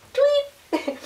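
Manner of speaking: high chirpy tweet; squeaky bird call for the baby; birdie tweet. Pretend. Animal sound game with baby.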